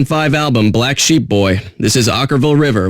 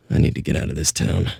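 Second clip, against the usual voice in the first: muttered aside; 8 semitones lower, 7 dB quieter, faster than usual. Acted.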